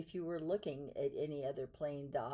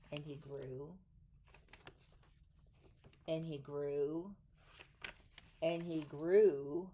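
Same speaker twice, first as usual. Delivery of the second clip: each "and he grew" slower and stronger; building suspense; 2 semitones lower, slower than usual. A narrator's reading. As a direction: stretch it out, let it build.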